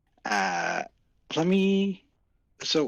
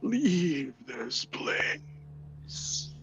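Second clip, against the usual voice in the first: slow, drawn-out ghostly whisper; breathless undead voices; 10 semitones higher, much slower than usual. Acted. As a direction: whisper it like the restless dead. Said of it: "you just start hearing breathless voices saying".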